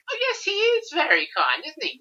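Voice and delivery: very high, mock-gushing voice; gushing agreement. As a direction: pitch way up, playing the smitten viewer. Voices viewers' fond reaction to Norman.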